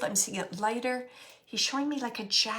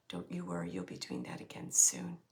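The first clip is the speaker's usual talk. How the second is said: deep hushed whisper; 8 dB quieter than usual. Retold or imitated. Reassuring message repeated much lower.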